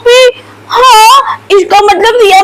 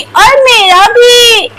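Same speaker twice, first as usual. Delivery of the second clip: ordinary talk, nothing marked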